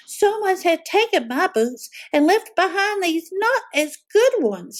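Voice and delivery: high, a touch of a cub's wail; wailing Baby Bear. A narrator's reading. Baby Bear missing her boots, mock wail. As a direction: whine like a little cub who lost her boots. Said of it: "she wailed".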